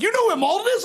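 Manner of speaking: can't believe he knows it; surprised question. Retold or imitated. Narrator as a kid asking the wrestler, surprised. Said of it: "I'm like".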